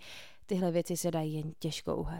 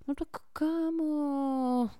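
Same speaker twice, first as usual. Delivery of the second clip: slow, higher whine dragged out; whining; 8 semitones higher, much slower than usual. A narrator's reading. Tucker whines back at Cole's order: slow drawn-out whine. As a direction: whine like a sulking kid. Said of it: "zakňučel Tucker".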